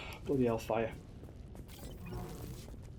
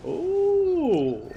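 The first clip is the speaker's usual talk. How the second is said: long, high "ooh" gliding down at the end; drawn-out ooh; 10 semitones higher, 5 dB quieter than usual. Real, not acted. Player reacting to the game, high drawn-out ooh.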